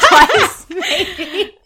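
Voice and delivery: high loud laugh on twice. Real, not acted. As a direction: giggle the correction. Laughing at own joke.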